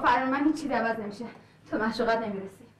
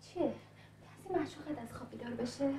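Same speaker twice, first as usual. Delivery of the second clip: much softer, slow, sneering aside; sarcastic hush; 13 dB quieter, slower than usual. Acted. Told to keep quiet, she taunts him about his sleeping lover in a soft sneer.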